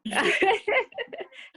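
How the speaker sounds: quick surprised laugh at a joke; a bright burst of laughter; amused laugh. Real, not acted. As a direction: laugh it off, caught off guard. Joke about kids coming with house.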